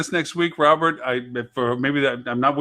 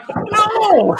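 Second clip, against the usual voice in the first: high, drawn-out mock wail of "no"; horrified cry; 4 dB louder than usual. Editor spotting a mistaken transcript, comically horrified. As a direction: cry out in comic dismay, pitch leaping. Retold horror at transcript error.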